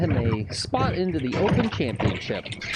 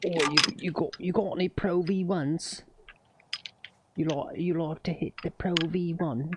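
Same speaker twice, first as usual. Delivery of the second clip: lightly put-on British accent, teasing; a playful British golfer voice, lightly done. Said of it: "a guy who talks just like that".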